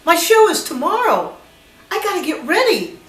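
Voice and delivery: high-pitched mock protest; retold protest. Retold protest at surprise show.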